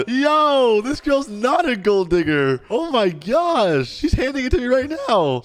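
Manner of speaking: high, loud, laughing amazement; delighted disbelief. Real, not acted. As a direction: gush in happy shock. Surprised she is buying.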